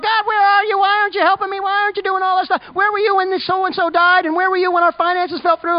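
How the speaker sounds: high, complaining plea to God; whiny ant complaining to God. Retold or imitated. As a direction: whine it out like a helpless little ant. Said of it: "that ant's throwing their arms up in the air going".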